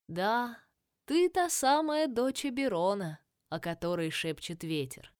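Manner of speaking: put-on old tree spirit, slow and solemn; ancient dryad. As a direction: ancient crone speaking gravely. Dryad voice, held.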